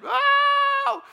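high loud squealing oh; secretary squealing over a big donation; secretary scream. Retold or imitated. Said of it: "and she screamed".

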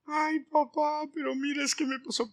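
put-on high, soft pleading child voice; child's whiny excuse. Child excusing himself to scolding dad, high and soft. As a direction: whine it like a caught kid. Child whining to father in example.

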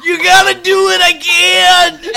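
high, loud, straining plea; desperate begging. Retold or imitated. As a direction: plead at the top of your voice. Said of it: "He just goes".

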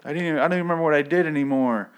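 high, fast pleading whine; mock a captive begging to be let out; exaggerated desperate prisoner voice; whiny prisoner.

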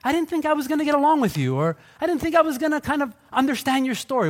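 mock surprised admission. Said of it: "be like".

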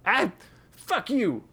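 high angry shout. A narrator's reading. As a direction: spit it at him. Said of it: "shouted Daggett".

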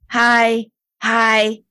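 bright high little hi, hi; chirpy greeting. Retold or imitated. Girl greeting the haunt actors, high and chirpy. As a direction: play a brave kid waving hello. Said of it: "she just starts going".

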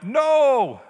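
a sudden high, loud, ringing "No!"; emphatic no. Retold or imitated. Pastor voicing Paul's defiant answer, a loud emphatic "No!". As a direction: slam the "No!" down hard and high. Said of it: "comes back with an emphatic".

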